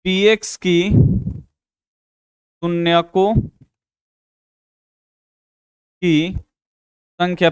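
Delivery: word by word, slow and drawn out, as if writing; slow dictation. Shown as a demonstration. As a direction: dictate each word slowly for the class to copy. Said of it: "लिखिएगा कि px".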